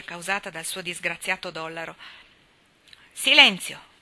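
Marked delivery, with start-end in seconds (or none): 3.1-3.9 a loud, commanding call for silence; stern order. A narrator's reading. Papà Baer loudly commands the noisy boys to be silent. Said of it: "ordinò papà Baer".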